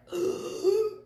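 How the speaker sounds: a sudden high gasp of shock; sharp gasp. Retold or imitated. High startled gasp. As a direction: gasp as the medium gets her brother right. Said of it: "and I was like".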